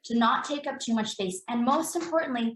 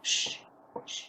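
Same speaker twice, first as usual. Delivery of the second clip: hushing shh; 6 dB quieter than usual. Retold or imitated. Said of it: "what do we do to them".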